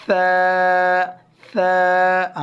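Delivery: tongue to upper teeth for سا; correct sa without whistle. Shown as a demonstration. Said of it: "تو ہم پڑھیں گے".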